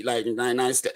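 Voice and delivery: gently, sweetly softened; soft, nice tone. Shown as a demonstration. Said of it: "trying to say it nicely".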